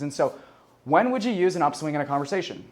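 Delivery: usual speaking voice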